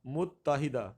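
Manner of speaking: متحدہ firmly as mut-ta-hida; correct pronunciation. Shown as a demonstration. Teacher corrects the option, careful pronunciation. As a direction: keep the double t in متحدہ. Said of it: "option میں غلطی ہے".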